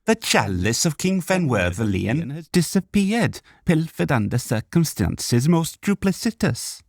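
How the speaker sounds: sing-song Welsh outrage; Welsh elf. A narrator's reading. Arundel the elf complains about the stolen chalice, Welsh lilt.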